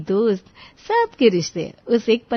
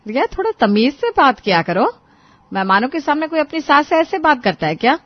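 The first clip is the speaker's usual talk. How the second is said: raised, louder voice of a displeased mother-in-law; annoyed scolding; 6 semitones higher, 6 dB louder than usual. A narrator's reading. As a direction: tick her off for bad manners. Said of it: "चेहरे पर हल्की नाराजगी आ गई". (नाराज़ सास की डाँट)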